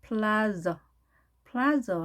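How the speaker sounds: 플라저 as PLAH-zuh, clear L and buzzed Z; English pronunciation. Shown as a demonstration. Teacher demonstrating English plaza, 플라저 as PLAH-zuh. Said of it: "영어식으로 발음해 볼까요".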